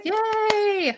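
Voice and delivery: high, drawn-out little cheer; celebratory cheer. Real, not acted. Host congratulating a giveaway winner, cheering.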